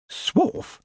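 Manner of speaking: puzzled queen. A narrator's reading.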